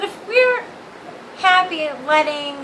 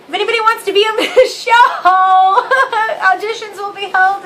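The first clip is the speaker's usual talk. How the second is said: laughing; 4 semitones higher, 6 dB louder, faster than usual. Real, not acted. Laughing at own joke.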